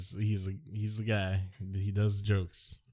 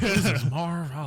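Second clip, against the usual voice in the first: higher, louder sportscaster voice; Marv Albert impression; 7 semitones higher, 4 dB louder than usual. Shown as a demonstration. Host shows off his Marv Albert to his co-host. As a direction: announce yourself like the TV announcer. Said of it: "Can I do Marv Albert?".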